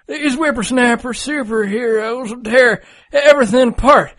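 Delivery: put-on high cranky old man. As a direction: croak it like an old grump. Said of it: "old man voice".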